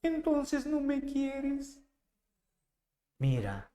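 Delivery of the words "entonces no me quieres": high, slow, soft pouty whine; high sulky girlfriend voice; pouting girlfriend. Retold or imitated. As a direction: sulk like a spoiled lover. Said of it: "Y ella hincha su cachete".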